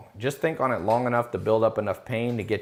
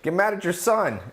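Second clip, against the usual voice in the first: higher, exasperated mock retort to the parents; 10 semitones higher than usual. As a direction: fire it back at the complaining parents. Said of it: "I'm like".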